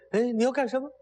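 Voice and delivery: high-pitched startled demand; alarmed question. Acted. Dropped official asks captor what he wants, high alarmed question. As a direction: pitch it up as a mock-alarmed victim.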